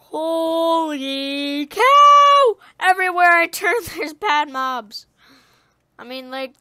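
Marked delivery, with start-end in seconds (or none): 0.1-5.1 hugely drawn-out, high exclamation; loud astonishment. Real, not acted. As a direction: stretch the shock out, then yell the complaint. Bad mobs everywhere.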